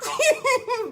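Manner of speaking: laughing. Real, not acted. Caller laughing signing off.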